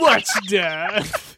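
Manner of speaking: loud mock action-hero tagline; hammed-up catchphrase. He turns to the camera and says it, loudly hammed-up. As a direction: belt it out like a cheesy title drop.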